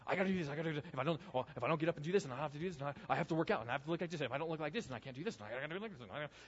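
rattled-off mock panicky list, trailing away; fretting control freak. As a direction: imitate a control freak spiralling without a breath.

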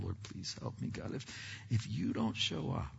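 soft, urgent whisper to God; whispered prayer. Retold or imitated. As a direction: pray under your breath backstage. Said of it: "I'm in the back room going".

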